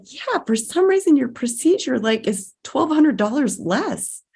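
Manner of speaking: cheerful caller. Retold or imitated. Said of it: "they called after and said".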